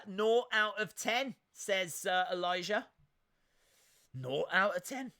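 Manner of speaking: ordinary delivery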